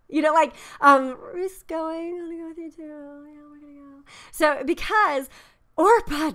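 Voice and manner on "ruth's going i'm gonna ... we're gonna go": high, quick, half-hearted promise; breezy, insincere yes; Orpah's half-hearted voice. Retold or imitated. Sister-in-law pledging loyalty to Naomi, high and offhand. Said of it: "you can almost hear Orpah's voice going".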